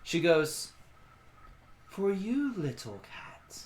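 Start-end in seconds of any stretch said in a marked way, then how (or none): 1.9-3.6 soft and tender; lightly voiced ancient guardian; ancient creature voice. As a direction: gently offer the gift.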